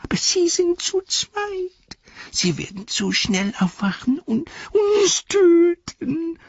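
high, whining plea; whimpering Smeagol. Acted. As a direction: whimper it, frightened and pleading. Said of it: "jammerte Smergol".

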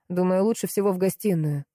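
ordinary delivery

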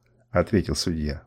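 ordinary delivery, nothing marked

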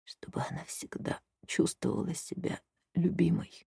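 faint breathy dying whisper; weak whisper. Acted. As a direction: whisper it with your last strength. Dying man's last request.

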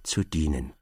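low, bowed-down murmur; a cowed man bowing deeply; lightly voiced servile client; humble servile reply. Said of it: "blieb tief gebückt stehen und sagte".

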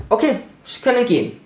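a hint of a hurried doctor waving him off; doctor dismissing patient. Imitating the doctor sending the injured patient home, brisk and quick. Doctor voiced in retold story.

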